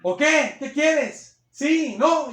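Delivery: loud, high confrontational jabs; rude shouted challenge. Retold or imitated. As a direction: pick a fight with it. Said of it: "y le grita a la gente". (reto grosero a gritos)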